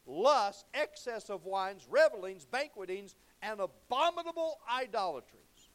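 loud, punched list of sins from the pulpit; preaching at full force. Acted. Preacher reading sins to his church, loud and forceful. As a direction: hammer each sin out to the congregation.

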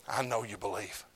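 low, soft and certain; Paul answering quietly to the king; quiet knowing reply. Retold or imitated. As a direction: drop to a hush. Paul answers his own question.

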